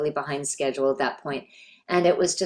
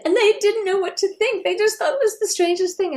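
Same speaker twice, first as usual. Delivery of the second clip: amused mom; 5 dB louder than usual. Retold or imitated. Mother tells daughter on phone.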